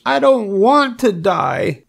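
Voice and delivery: high, drawn-out piglet wail; tearful moan. A narrator's reading. Wilbur bursts into tears, high drawn-out moan. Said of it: "he moaned".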